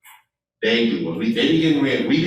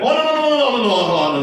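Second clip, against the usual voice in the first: high mock voter, a bit louder; voters refusing; 11 semitones higher, 3 dB louder than usual. Voters refusing to read further, high mock protest. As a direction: squeal out the refusals. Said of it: "They're like".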